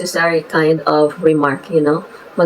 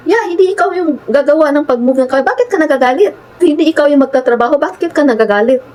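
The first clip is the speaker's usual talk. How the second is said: higher, pointed questioning; exasperated tone; 7 semitones higher than usual. Retold or imitated. Confronting the annoyed engineer, exasperated and raised. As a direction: push back, baffled by his anger. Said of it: "this was my tone now".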